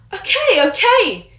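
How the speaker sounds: exasperated giving in. Acted. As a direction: throw up your hands. Remus gives in under scolding.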